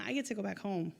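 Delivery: ordinary delivery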